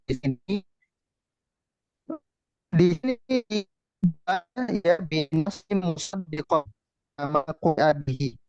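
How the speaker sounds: choppy breaking-up call audio; corrupted glitchy audio. Real, not acted. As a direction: voice cutting in and out online. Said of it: "Ustaz, suaranya putus-putus".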